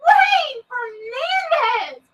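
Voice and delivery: high, slow scolding shout of a name; scolding call. Real, not acted. Streamer scolding a cheeky viewer, calling out. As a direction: call him out like a shocked mum.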